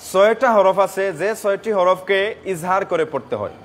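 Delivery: normal talking voice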